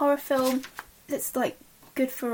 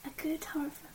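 whisper; 4 semitones higher, 10 dB quieter than usual. Real, not acted. Hushed aside in chat.

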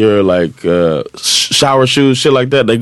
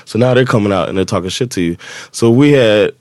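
ordinary talk

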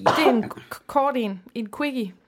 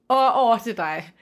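playful call-out; 2 semitones higher, 8 dB louder than usual. Pretend. Host playfully batting the turn over to co-host, loud call-out. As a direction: call it across like a ping-pong serve.

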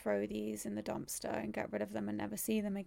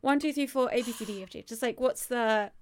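high, louder mock patience turning demanding; mock polite then demanding; 3 semitones higher, 6 dB louder than usual. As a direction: humour him then push. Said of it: "I was like".

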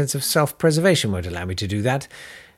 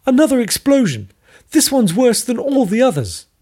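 much higher, louder alarmed woman; urgent scientist; 12 semitones higher, 6 dB louder than usual. Acted. Maggie warns the visitors, high and alarmed. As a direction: sound the alarm, breathless with news.

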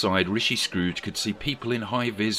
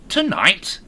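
high, sharp alarmed cry; 11 semitones higher, 7 dB louder than usual. A narrator's reading. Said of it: "cried Scrooge".

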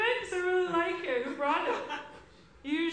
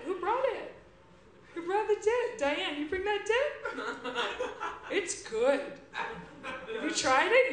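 dip-obsessed party guest; 3 semitones lower than usual. Acted. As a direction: mumble happily about the dip. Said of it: "this first character".

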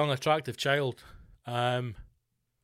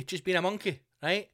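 usual speaking voice

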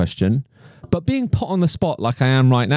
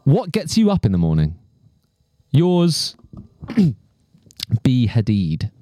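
reading a letter aloud; slower than usual. Acted. Reads letter sign-off.

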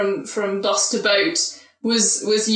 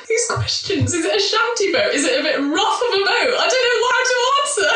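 laughing flustered; 10 semitones higher than usual. Real, not acted. Flustered by silly boat questions.

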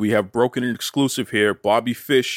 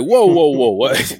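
rising, louder startled whoa; surprised whoa; 5 semitones higher, 6 dB louder, slower than usual. Real, not acted. Host reacting to a surprise, startled. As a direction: jolt back in disbelief.

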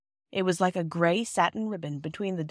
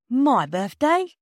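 slow, high, loud questioning; incredulous porter echoing a question; taken aback; 7 semitones higher, 6 dB louder, much slower than usual. A narrator's reading. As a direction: draw it out in surprise.

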